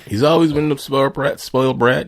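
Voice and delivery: loud rant. Real, not acted. Ranting about Trump.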